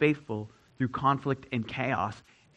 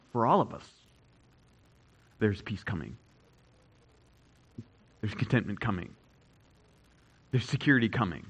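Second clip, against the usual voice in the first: tender; 6 semitones lower, 4 dB quieter, faster than usual. Acted. Tender contrast after preaching.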